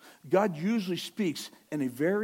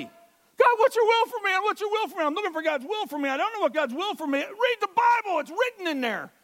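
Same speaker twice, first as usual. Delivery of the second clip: very high, loud, exasperated rant; mock frustrated; 8 dB louder than usual. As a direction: play the fed-up God, hammed up. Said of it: "I say that not angry. It just sounded like it".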